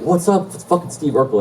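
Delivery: high, rowdy greeting; drunk hollering. Retold or imitated. Drunk frat brother greets arriving TV star, high rowdy holler. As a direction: announce the celebrity to the whole party.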